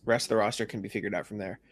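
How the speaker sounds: ordinary talk, nothing marked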